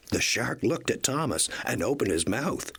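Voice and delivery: ordinary delivery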